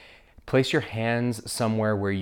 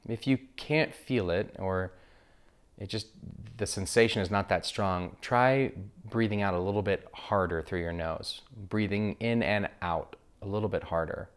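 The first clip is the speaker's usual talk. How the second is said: guided meditation. Acted. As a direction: guide listeners gently into breath awareness, unhurried.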